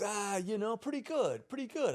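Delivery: a hint of an old surfer, relaxed; laid-back old surfer. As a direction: lightly voice a mellow old surfer. Voicing old surfer Paul.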